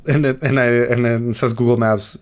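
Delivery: usual speaking voice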